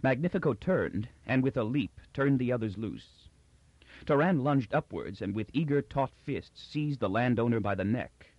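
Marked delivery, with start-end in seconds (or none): none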